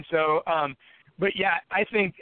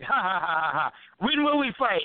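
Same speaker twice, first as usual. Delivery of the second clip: imitated giggle. Rival giggling as he asks again, mocking imitation. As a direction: mimic a giggling pest asking his question. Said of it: "and then he giggles".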